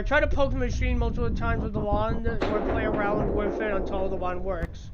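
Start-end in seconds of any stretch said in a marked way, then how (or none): none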